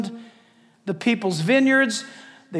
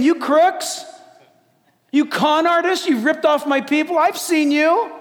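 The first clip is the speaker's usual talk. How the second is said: raised, forceful denunciation; heavy accusation; 5 semitones higher, 4 dB louder than usual. Acted. The Lord to crooked leaders, forceful high accusation. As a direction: accuse them as an outraged judge. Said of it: "Whoa, this is heavy. He's saying".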